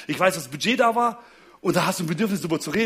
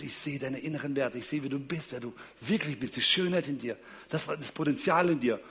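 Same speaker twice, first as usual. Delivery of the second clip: soft, low affirmation; tender, sincere; 4 semitones lower, 8 dB quieter than usual. Acted. Explaining what "I see you" means to partner, tender. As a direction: lower the voice and affirm them intimately. Voices the Na'vi greeting's tender meaning.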